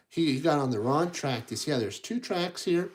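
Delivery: plain reading voice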